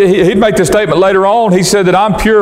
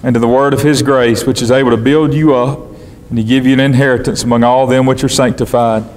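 lower, measured reading of the Bible text; scripture reading; 5 semitones lower than usual. Acted. Preacher reading scripture to his congregation, solemn and measured. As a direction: read the verses solemnly to the congregation.

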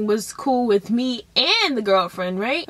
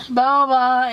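ordinary delivery

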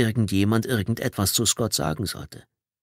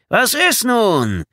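slow, high, loud holler; calling out; 14 semitones higher, 7 dB louder, much slower than usual. A narrator's reading. Trader Vic pressing for the ball, shouting. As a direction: yell impatiently across the field. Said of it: "rief Trader Vic erneut".

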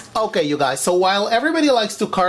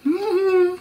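high delighted mm-hmm; 14 semitones higher than usual. Real, not acted. Tasting food.

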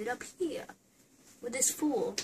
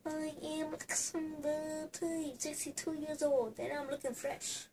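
put-on old man voice; 5 semitones higher, 6 dB quieter than usual. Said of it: "You know, two old men".

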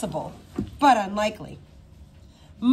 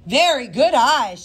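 slow, louder, impressed praise; 4 semitones higher, 6 dB louder than usual. Acted. Headmistress praising the detective's sharp eyes, slow and impressed. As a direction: savour the compliment, louder and slow. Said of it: "she said, impressed".

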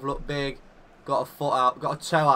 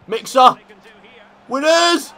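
excited yell; 10 semitones higher, 9 dB louder than usual. Real, not acted. Goal celebration in manager game.

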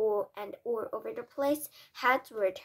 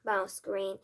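plain reading voice

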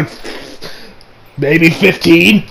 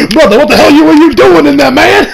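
louder, higher half-laughing shout; mock outraged yell; 8 semitones higher, 6 dB louder than usual. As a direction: yell at him as if scandalized. His own outburst to the character.

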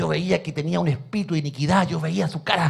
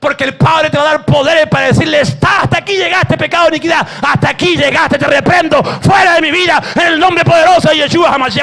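loud, higher commanding rebuke; shouted rebuke; 8 semitones higher, 9 dB louder than usual. Acted. Preacher shouting a rebuke of sin for the congregation. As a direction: rebuke sin with full force.

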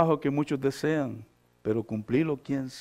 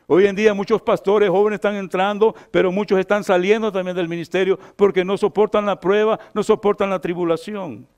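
fervent preaching; 3 semitones higher, 5 dB louder than usual. Real, not acted. Pastor exhorting would-be ministers, fervent and loud. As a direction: drive each point home from the pulpit.

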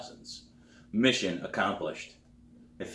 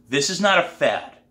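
firm, raised-voice insistence; stern teacher; 8 semitones higher, 7 dB louder than usual. A narrator's reading. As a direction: lay down the law, louder and higher. Teacher lays down the law.

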